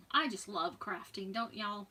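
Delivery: normal talking voice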